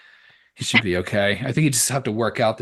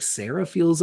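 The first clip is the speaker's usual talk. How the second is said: ordinary talk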